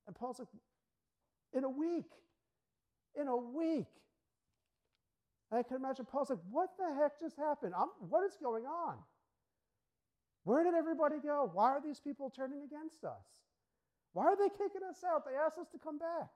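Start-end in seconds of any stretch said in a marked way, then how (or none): 1.5-3.9 higher, disbelieving repeat; dismayed Paul. Retold or imitated. Paul, thrown out after a week, stunned disbelief. 6.5-16.3 high, baffled questions; bewildered Paul. Retold or imitated. Paul wonders why the crowd turned, baffled and higher.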